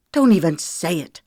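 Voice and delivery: loud spat-out refusal; angry woman cutting off his apology. Acted. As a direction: spit the words at him. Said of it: "she spat".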